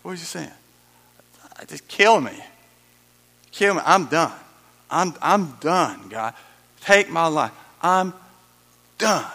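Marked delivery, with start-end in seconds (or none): 1.7-9.3 loud, desperate plea to God; despairing cry. Acted. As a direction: cry out as if at the end of your rope. Preacher voicing Paul's despair.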